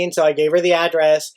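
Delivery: ordinary talk, nothing marked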